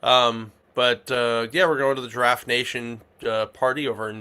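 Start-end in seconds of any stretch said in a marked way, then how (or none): none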